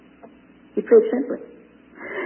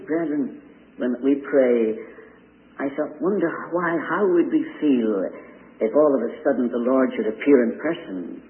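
normal talking voice

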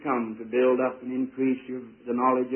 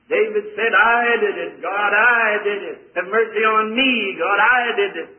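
raised, loud, drawn-out sermon cry; full-force preaching; 6 semitones higher, 6 dB louder than usual. Real, not acted. Preacher tells of David confessing to God, impassioned preaching. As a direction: build to a ringing cry of confession, high and loud.

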